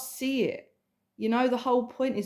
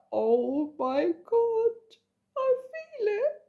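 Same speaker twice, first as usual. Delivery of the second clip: tearful elation; 11 semitones higher, slower than usual. Retold or imitated. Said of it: "to just be like".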